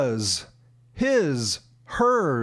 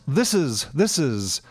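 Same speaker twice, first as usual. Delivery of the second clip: "This is" run together as "thisiz"; quick, linked "This is"; said fast; 5 semitones lower than usual. Shown as a demonstration. Teacher showing the fast way, "This is" run together. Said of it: "Or when said fast".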